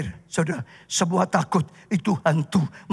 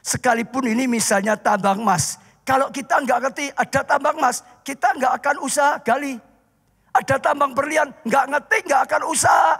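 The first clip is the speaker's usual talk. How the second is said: preaching at full force; 9 semitones higher than usual. Acted. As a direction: drive the story home to the congregation. Preaching about the fire within.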